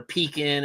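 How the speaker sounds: pecan with a long "pee" up front; pecan as PEE-can. Shown as a demonstration. Host showing another rejected Yankee version, pecan as PEE-can. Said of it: "you sound like a Yankee".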